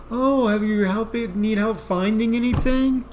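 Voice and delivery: high, bright store-clerk voice; chirpy helpful clerk; employee voice. Retold or imitated. Store employee greeting a lost shopper, high chirpy clerk voice. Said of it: "this employee comes around and goes".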